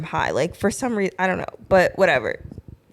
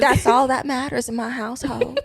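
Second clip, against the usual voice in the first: Southern belle; 4 semitones higher than usual. Pretend. Southern belle on her household's values, amused Southern accent. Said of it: "this little Republican Southern belle".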